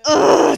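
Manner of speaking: loud frustrated "ugh"; exasperated groan. Real, not acted. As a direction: groan loudly, driven nuts. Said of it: "that drives me nuts".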